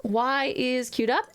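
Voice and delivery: normal talking voice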